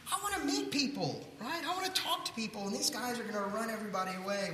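high, pleading whine; whiny complaining. Retold or imitated. Young man whining to his campus minister about the trip. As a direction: whine like a reluctant student.

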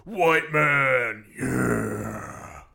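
loud hammed-up soundbite; mock Trudeau. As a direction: belt the soundbite like a parody. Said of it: "he's just going around and be like".

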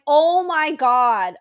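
loud, drawn-out, fed-up outburst; exasperated barista. Retold or imitated. Barista fed up with calling a customer, exasperated. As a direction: imitate a worker who has lost patience.

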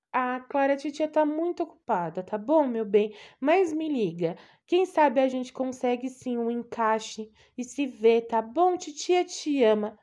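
loud, high-pitched caring aunt; doting aunt. Acted. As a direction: gush over her like an anxious old aunt. Aunt brushing her off.

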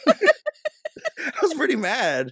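high, slow words dissolving in laughter; laughing. Real, not acted. Telling co-host about the toilet seat, laughing. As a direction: laugh through the confession.

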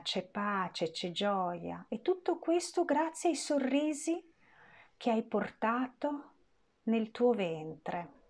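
normal talking voice

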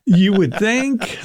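slow, high ironic drawl; drawn-out irony. Real, not acted. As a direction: stretch it out wryly. Ironic remark.

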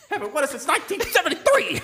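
a light, laughing imitation of a loud sports pundit, pitched high; Stephen A. Smith impression. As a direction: hype it up like an outraged TV analyst, half laughing. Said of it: "just to see Stephen A. Smith's reaction".

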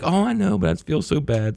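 high, soft sweet-lady voice; imitating the kind neighbor lady, sympathetic. Retelling how the neighbor lady answered, high and sweet. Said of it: "She's like".

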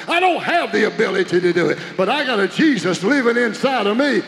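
loud fervent preaching; full-force preaching. Acted. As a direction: drive it home to the congregation, loud.

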